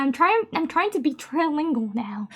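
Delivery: ordinary talk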